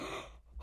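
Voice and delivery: sharp frightened intake of breath; startled gasp. Real, not acted. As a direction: gasp at a sudden scare. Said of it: "that really scared me".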